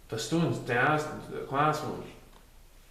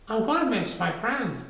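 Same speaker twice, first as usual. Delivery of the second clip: put-on child voice; 9 semitones higher than usual. Child voiced in read story.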